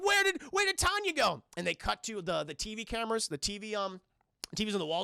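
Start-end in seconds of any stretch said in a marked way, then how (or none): 0.0-1.4 high mock-panicked shout; alarmed question. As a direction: ask it high, in acted alarm. Friends alarmed Tanya vanished.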